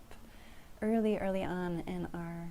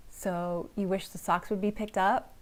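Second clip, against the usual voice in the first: louder, edgy, voice going up at the end; agitated rising question; 6 dB louder than usual. Shown as a demonstration. Partner reflecting back about the socks, agitated upturn. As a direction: snap it out like an irritated question. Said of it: "with some agitation and tone in the voice".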